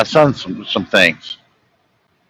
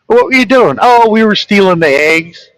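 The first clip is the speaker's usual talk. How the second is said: retold exchange; 9 semitones higher than usual. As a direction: play the dad question and the son's answer brightly. Retold dialogue, chickens.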